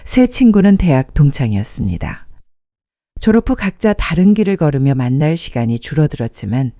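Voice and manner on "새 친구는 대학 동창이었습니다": usual speaking voice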